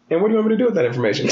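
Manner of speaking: quick, high mock-blunt mom voice, laughing; mom's blunt reply. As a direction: rattle it off fast like an unimpressed mother. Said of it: "she was like".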